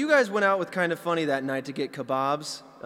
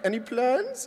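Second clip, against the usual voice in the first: higher mock German accent; German Willy Wonka; 6 semitones higher, slower than usual. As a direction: ask as the theatrical German waiter. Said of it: "like, a German Willy Wonka".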